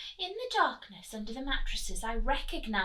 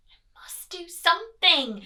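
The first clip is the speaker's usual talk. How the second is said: desperate; 5 semitones higher, 11 dB louder than usual. A narrator's reading. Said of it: "I thought desperately".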